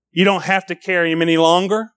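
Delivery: ordinary talk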